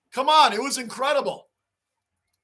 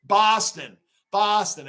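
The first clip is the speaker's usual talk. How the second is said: "Boston" as "Bahston", Boston style; Boston accent; 2 semitones lower, slower than usual. Retold or imitated. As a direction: imitate a Bostonian, open "ah" in "Boston". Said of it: "New York accents and Boston accent".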